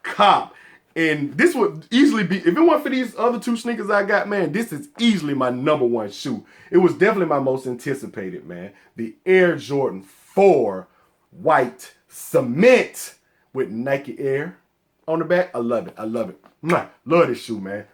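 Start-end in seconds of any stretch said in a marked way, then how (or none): 0.0-0.5 punchy, spaced-out words; emphatic joint verdict. Retold or imitated. Retelling the moment with his friend, emphatic verdict. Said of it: "We both looked at each other and said". 16.6-16.9 a quick smacked "mwah"; kiss sound. Real, not acted. Sneaker fan adoring his shoe, kiss sound. Kisses toward the beloved shoe.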